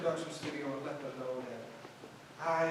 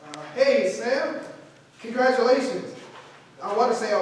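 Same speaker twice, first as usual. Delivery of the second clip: excited; 5 semitones higher, 9 dB louder than usual. Acted. Excited phone call to Sam.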